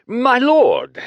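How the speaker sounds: breathless high gasp of fear. A narrator's reading. Gasping plea. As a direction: auctioneer gasps up at the Sindonian lord. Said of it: "he gasped".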